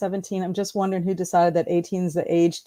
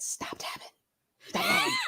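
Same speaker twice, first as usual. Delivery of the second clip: near-silent whispered warning; retold menacing whisper; hushed warning; 11 dB quieter than usual. Teacher acts out warning a tapping student.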